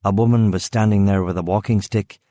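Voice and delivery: plain reading voice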